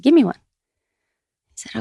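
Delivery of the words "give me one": higher and louder, firm demand; insist, leaving no room to refuse; insistent guru. Retold or imitated. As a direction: guru pressing his demand.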